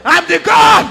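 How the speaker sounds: fervent prophetic preaching as the voice of God. Acted. Prophet voicing God to Amos, preaching fervently. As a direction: declare it like a prophet bringing God's word.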